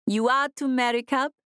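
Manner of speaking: put-on a questioning woman with an African accent; heavy African accent. A narrator's reading. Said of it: "in her heavy African accent".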